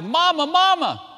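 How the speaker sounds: high, loud, drawn-out cry for mother; frightened child's cry. Retold or imitated. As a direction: act out a scared boy calling out. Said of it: "the little boy cries out".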